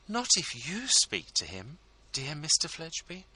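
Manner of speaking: high, effusive ladylike voice; fawning hostess; gushing society lady. A narrator's reading. Mrs Lammle voiced by narrator.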